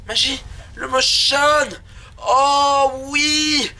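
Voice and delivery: high, ecstatic exclamation; hammed-up delight. Player reveals motion aiming with the bow, hammed-up delight. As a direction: cry out in exaggerated rapture.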